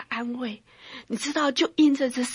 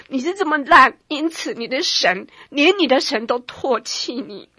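devil accusing; 3 semitones higher, 6 dB louder than usual. Retold or imitated. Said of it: "他说".

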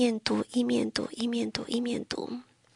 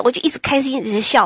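ordinary talk

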